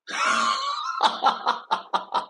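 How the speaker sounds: high unvoiced laugh; high breathy laugh. Real, not acted. [name] mocking the excuse, high breathy laugh. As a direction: laugh at the idea.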